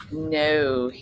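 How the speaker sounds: usual speaking voice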